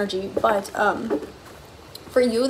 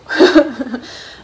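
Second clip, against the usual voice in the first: loud burst of laughter; 3 semitones higher, 7 dB louder than usual. Real, not acted. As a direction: burst out laughing after a knowing remark. Laughs aloud after "I see you.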